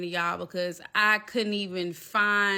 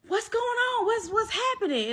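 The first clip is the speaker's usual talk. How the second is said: very high, urgent imitation; alarmed friend; 14 semitones higher than usual. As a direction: fire off worried questions in a high voice. Best friend's alarmed questions, acted.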